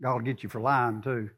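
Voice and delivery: ordinary talk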